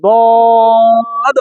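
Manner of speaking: দদ held long; teacher's model letter; Arabic dad sound. Shown as a demonstration. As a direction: model দদ clearly and slowly. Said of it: "এবার আবার শোনেন".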